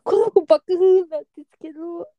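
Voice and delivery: high, wailing mock sob; tearful explaining. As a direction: explain through hammed-up tears. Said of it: "泣きながらめっちゃ説明したのね".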